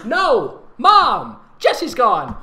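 loud hammed-up yelling; mock scream. Narrator screaming to her mother about her lost sister, loud. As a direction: scream it into the phone. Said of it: "I screamed into the phone".

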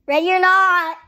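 loud seeker call. Real, not acted. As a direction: call it out to the whole house. Seeker calls out to hiders.

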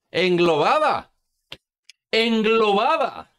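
slow, drawn-out incredulous repeat; savouring it in mock disbelief; mocking echo. Mockingly echoes senator's odd word.

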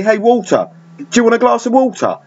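"Walter" and "water" said with no clear L or T, glottal stops; South London accent. Shown as a demonstration. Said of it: "they don't even use the, the letter L".

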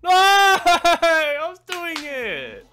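loud, high, very slow laughing wail; anguished wail. Real, not acted. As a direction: wail it in outraged disbelief. Anguished wail after losing.

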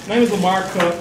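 usual speaking voice

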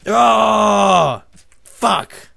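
long high yell, then a curse; loud howl of dismay; pained outburst. Real, not acted. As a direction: cry out as if just hit. Gets hit in game.

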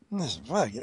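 ordinary talk, nothing marked

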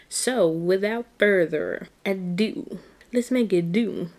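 loud, drawn-out silly announcing; put-on hype voice. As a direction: play up the intro in a put-on voice. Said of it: "I don't know why I'm talking like this".

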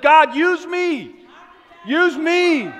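slow, loud cry of surrender; shouted prayer. Acted. Preacher voicing a believer's prayer in a loud, slow cry. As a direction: cry out to God at full voice.

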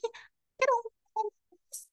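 a high mock wail of pain; crying out. Greedy rabbit cries out to his friends, high mock wail. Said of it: "صرخ أوشي".

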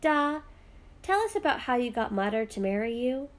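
ordinary delivery

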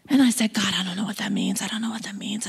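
breathy, pleading whisper to God; hushed, confused retold prayer. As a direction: whisper it like a bewildered plea. Retold desperate prayer in Bible college.

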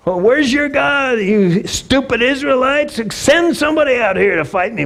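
giant's taunt. Acted. Goliath challenging Israel daily, hammed-up jeer. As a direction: call out the challenge with a sneer. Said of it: "making his daily".

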